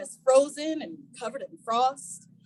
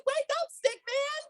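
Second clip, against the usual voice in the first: called wake-up; 11 semitones higher, 3 dB quieter than usual. Shown as a demonstration. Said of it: "Everybody say".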